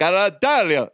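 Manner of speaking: high, badly faked Italian accent; botched Italian accent. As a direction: try a fake Italian accent and miss. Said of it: "that's not even Italian".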